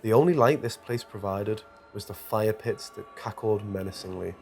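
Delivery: usual speaking voice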